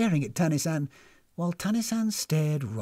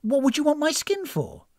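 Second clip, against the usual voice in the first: high-pitched, indignant question; alarmed; 11 semitones higher than usual. A narrator's reading. Guest hearing her skin admired, alarmed. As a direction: recoil in alarmed suspicion. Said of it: "clearly alarmed".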